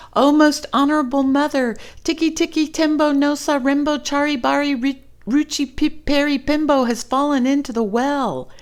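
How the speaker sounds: put-on high, loud, fast breathless boy; a little boy rushing to tell his mother; urgent child plea. Little Chang urgently telling his mother, high and fast.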